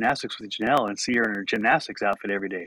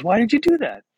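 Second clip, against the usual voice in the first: higher, louder mock-baffled attendant; questioning attendant; 12 semitones higher, 7 dB louder than usual. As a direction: push it up, loud and incredulous. Attendant questions him, voiced higher.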